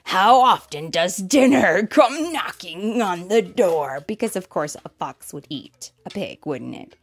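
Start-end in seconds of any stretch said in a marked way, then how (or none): 0.0-4.0 loud, slow, exultant fox; big drawn-out shout of delight. Acted. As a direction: crow it out at full voice. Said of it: "the fox shouted".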